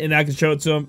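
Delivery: ordinary talk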